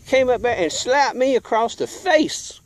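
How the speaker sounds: ordinary talk, nothing marked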